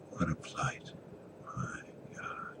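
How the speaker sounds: soft hopeless whisper; whispered despair. Acted. Evan in the closet, fading whispered despair. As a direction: let it fade to nothing.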